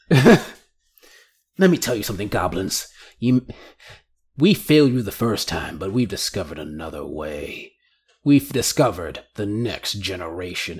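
villain turning low and quiet; sinister villain. Acted. Villain regains composure, sinister.

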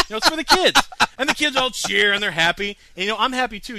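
host bragging to co-host, loud and gleeful; excited. Real, not acted. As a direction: crow it out like a proud showman. Boasting about kids cheering.

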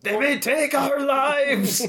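high, very slow mock Scottish battle cry; hammed-up Scottish rallying speech; slow theatrical Scottish warrior voice; Scottish Braveheart.

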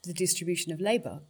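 ordinary delivery

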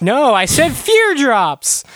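loud, high, drawn-out mock outrage; irate complaint. Villain's customer who got the wrong drops, hammed-up outrage. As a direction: shout it like a furious customer.

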